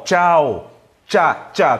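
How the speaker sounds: "Tchau", then just "Tchá", on a crisp tch; tch sound. Shown as a demonstration. Teacher isolating the CH sound, crisp tch. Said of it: "Tem essa pronúncia de tchá".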